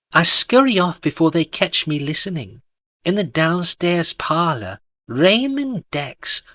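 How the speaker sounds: usual speaking voice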